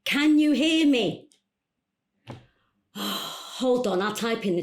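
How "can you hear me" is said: louder, higher, drawn-out question; calling out on bad connection. Caller to coach who can't hear her, calling out slowly. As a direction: say it big and slow for a failing connection. Repeats for unhearing caller on Zoom.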